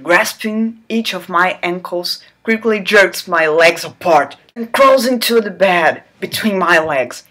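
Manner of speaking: normal talking voice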